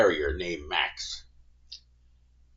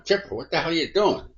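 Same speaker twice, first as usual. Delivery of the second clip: raised, higher alarmed call across the yard; 9 semitones higher than usual. Retold or imitated. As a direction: shout a startled question at him. Calling out to armed friend.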